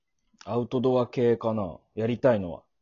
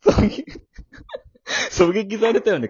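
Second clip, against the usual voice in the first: laughing; 10 semitones higher, 8 dB louder than usual. Real, not acted. Laughing after being shot in game.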